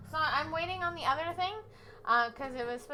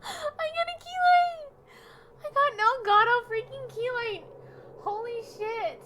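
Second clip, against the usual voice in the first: excited; 11 semitones higher, 8 dB louder than usual. Real, not acted. Unboxing prize, near tears.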